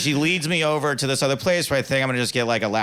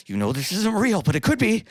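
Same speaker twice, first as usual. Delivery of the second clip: high-pitched wishful self-talk, acted out; hopeful self-persuasion; 9 semitones higher than usual. Talking himself into believing the stripper's interest, hopeful. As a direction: talk yourself into it, rising with hope.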